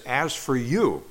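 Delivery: the you punched as emphatic. Shown as a demonstration. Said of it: "the you is emphatic".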